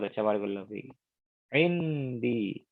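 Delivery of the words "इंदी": slow model word. Shown as a demonstration. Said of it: "teacher repeats word slowly".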